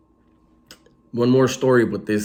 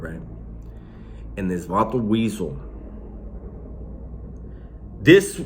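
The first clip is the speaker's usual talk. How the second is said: normal talking voice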